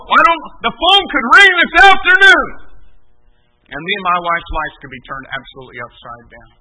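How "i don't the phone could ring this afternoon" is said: high, loud urgent warning; emphatic preaching shout. Real, not acted. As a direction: raise the voice high and drive it home.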